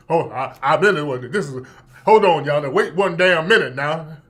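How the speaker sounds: low mock-butch objection; deep-voiced Jussie. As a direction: put on a deep voice and protest. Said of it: "his voice got deep".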